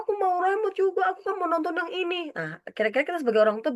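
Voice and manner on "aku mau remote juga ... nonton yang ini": high-pitched whiny demand; angry younger sibling. Acted. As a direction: act a cross child wanting a turn. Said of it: "dia marah".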